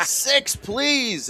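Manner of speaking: high-pitched mock plea for a six; mimicked plea. She was calling for blood, high-pitched begging for a six. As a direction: beg the dealer for the six in a squeaky voice. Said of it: "She said".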